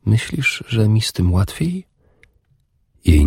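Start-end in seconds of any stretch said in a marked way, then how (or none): 0.1-1.9 higher and much softer; soft pained question. A narrator's reading. Warrior answering Urdis, soft and higher. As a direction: ask it softly, pained.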